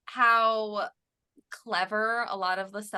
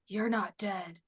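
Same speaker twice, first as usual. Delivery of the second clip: creepy voice; 3 semitones lower, 6 dB quieter, slower than usual. Retold or imitated. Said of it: "in, like, this creepy voice".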